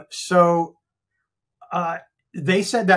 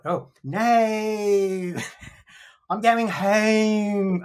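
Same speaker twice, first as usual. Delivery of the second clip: higher, drawn-out Aussie vowels; attempted Australian accent; 4 semitones higher, much slower than usual. Shown as a demonstration. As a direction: exaggerate the Australian vowels, slowly. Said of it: "conversational Australian accent is so hard".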